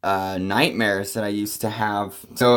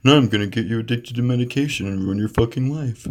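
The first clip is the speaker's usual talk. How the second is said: mock smug psychiatrist. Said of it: "They'll be like".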